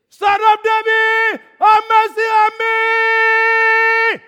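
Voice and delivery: very high, drawn-out full-voice yell. Shown as a demonstration. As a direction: scream it slowly, holding the last word long. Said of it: "he shouted the more".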